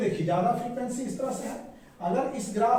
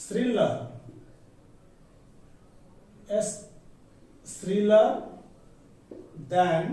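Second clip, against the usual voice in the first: each word on its own with long pauses; teacher reading notes aloud slowly; slow dictation; 2 semitones lower, much slower than usual. Shown as a demonstration. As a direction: dictate to the class at writing speed.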